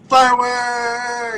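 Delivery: slow, high, stretched-out cheer; drawn-out cheer. Acted. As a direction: stretch it out like a party horn. Echoing the fireworks cheer.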